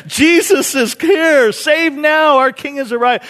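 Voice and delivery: very high, loud mock celebration; jubilant crowd. Crowd welcoming Jesus at the week's start, exuberant. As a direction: imitate an overjoyed crowd cheering. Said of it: "Beginning of the week".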